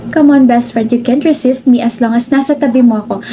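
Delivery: ordinary talk, nothing marked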